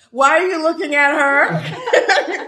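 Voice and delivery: amused, teasing laugh. Real, not acted. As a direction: tease through laughter.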